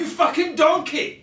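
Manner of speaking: high, slow, loud Scottish chef bellow with a laugh; laughing Scottish yell at a cook; Gordon Ramsay yell. Shown as a demonstration. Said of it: "Like that".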